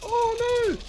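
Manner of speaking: very high, drawn-out wail; dismayed squeal. Real, not acted. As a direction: squeal as you get caught. Caught by opponent.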